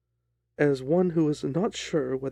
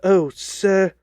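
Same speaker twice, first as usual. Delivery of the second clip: higher, loud cry, quivering with emotion; emotional cry; 5 semitones higher, 10 dB louder than usual. Acted. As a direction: cry out in desperate hope. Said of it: "he cried".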